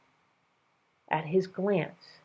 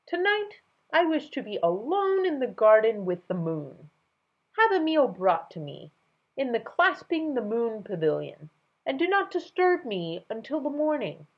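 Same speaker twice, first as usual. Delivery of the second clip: higher royal order; regal command; 7 semitones higher than usual. Acted. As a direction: command like a king. King's orders.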